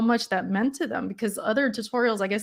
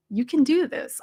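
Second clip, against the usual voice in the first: higher, rising cheer on "do"; bright rising pep talk; upbeat encouragement; 6 semitones higher than usual. Shown as a demonstration. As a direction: pump them up. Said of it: "I'll even tell them in the video".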